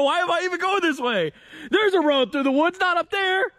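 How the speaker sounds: high-pitched alarmed questioning. Real, not acted. Driver finding a road through the woods, high alarm. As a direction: voice jumps up in alarm.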